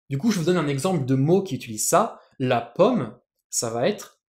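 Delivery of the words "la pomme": normal talking voice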